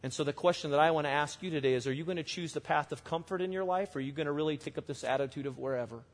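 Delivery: ordinary delivery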